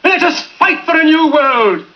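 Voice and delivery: building to a soaring shout; impassioned speech. Acted. Speech builds to rousing climax.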